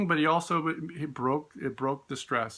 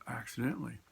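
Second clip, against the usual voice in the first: "accidentally" dropped to a hushed, knowing aside; quiet aside; 3 semitones lower, 10 dB quieter, faster than usual. Real, not acted. Teacher winks to listeners, quiet "accidentally". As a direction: slip in "accidentally" under the breath.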